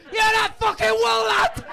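high, slow yelled accusation; hammed-up furious Scouse yell; Scouser shout. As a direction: bellow it across the canyon. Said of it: "be like".

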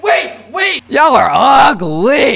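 high, loud drawn-out taunt; shrieked teasing heckle; yelled insult. Pretend. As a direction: call out urgently, then holler the insult slowly. Yelling an insult at strangers.

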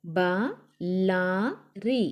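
"బలారి" very slow, split as ba, la, ri; letter by letter. Shown as a demonstration. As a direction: sound out "బలారి" with long gaps between letters. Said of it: "ఒత్తి పట్టి చదవాలి".

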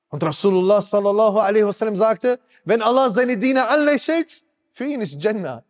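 hoch und eindringlich predigen, Stimme steigert sich; Predigt mit voller Kraft. Acted. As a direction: die Gemeinde aufrütteln, flehend und hoch. Preaches with rising intensity. (leidenschaftliche Predigt, hoch und drängend)

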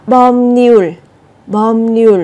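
"bom nhùl" slowly, with the b turned m and the r turned nh; drawn-out model of "bom nhùl" showing the sound change; Korean liaison. Shown as a demonstration. Teacher slowly demonstrates the sound change to the class. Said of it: "cũng đọc biến âm như thế này".

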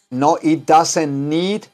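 very slow, each word apart; "it doesn't need" spelled out slowly; slow model sentence. Shown as a demonstration. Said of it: "le dice aquí". (despacio, palabra por palabra)

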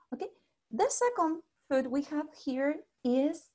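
ordinary talk

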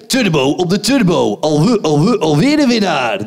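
low, loud carnival barker chant; fairground announcer voice. Acted. Comic hyping the ride crowd in his fairground voice. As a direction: bark it like a fairground ride host. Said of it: "dat is trouwens mijn kermisstem".

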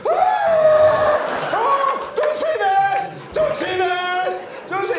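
very high, loud panicked scream; terrified wailing. Retold or imitated. Friend hears the place is haunted, terrified wail. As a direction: shriek in full comic terror, drawing words out. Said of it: "He starts crying".

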